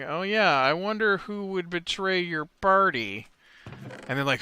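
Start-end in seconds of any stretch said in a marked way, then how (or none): 0.0-3.3 higher, knowing, leading tone; sly hint. Retold or imitated. Game master hinting at betrayal to the players, slyly. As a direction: nudge the players toward the answer. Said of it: "little aside with them, um, saying".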